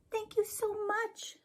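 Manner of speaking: very high, soft thankful rabbit; grateful rabbit voice. A narrator's reading. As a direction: breathe thanks as a tiny rabbit. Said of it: "said Rabbit".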